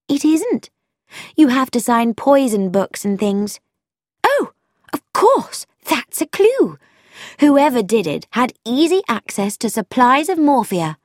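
sudden excited insight; young woman voiced, thrilled by a clue; Frankie, eager.

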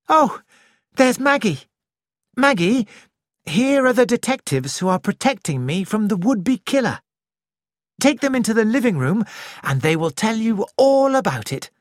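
put-on a young woman gaily calling to her cousin; Nick calling out. A narrator's reading.

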